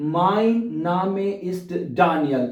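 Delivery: very slow, careful German model sentence; slow demo. Shown as a demonstration. Teacher modelling German for beginners, very slowly. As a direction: lay out each word slowly for learners. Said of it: "इस तरह भी आप बता सकते हैं".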